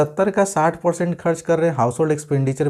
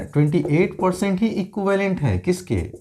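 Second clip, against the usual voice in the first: ordinary talk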